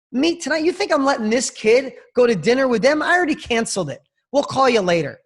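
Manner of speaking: imitating an indignant manager shutting it down; scornful refusal. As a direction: scoff at the very suggestion.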